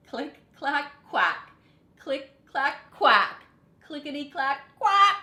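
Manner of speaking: typewriter and quack sounds. A narrator's reading. Typing and quacking sound effects.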